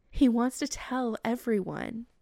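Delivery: usual speaking voice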